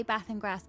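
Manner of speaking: bath and grass with short flat a as in cat; "bath and grass" said with a northern short a. Shown as a demonstration. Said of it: "So I say".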